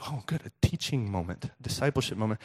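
note it quietly, as if thinking aloud; dad's private aside. Acted. Dad privately spotting a teaching moment, quiet aside. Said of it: "And Dad's like".

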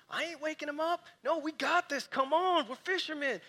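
high, brash bravado; cocky fishermen. Retold or imitated. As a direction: brush off the storm like tough pros. Proud fishermen refusing help.

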